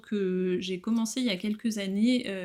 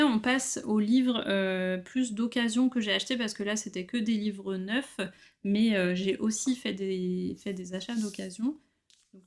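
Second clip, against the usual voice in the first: usual speaking voice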